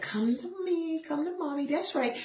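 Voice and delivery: put-on high, soft baby coaxing; a mother coaxing her son to walk, soft and high; coaxing mom. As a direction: coax the toddler gently toward you. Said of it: "I'm there like".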